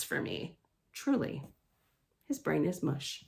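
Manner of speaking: for me trailing into a voiceless whisper. Acted. Worn-out kid reader whispers his misery. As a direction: let for me die out to a whisper. Weary end of complaint.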